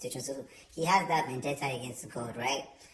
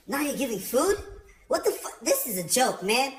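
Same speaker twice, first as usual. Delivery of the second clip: high, loud scoffing jeer; mocking outburst; 8 semitones higher, 6 dB louder than usual. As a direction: ridicule the ritual out loud. Paul mocking communion loudly.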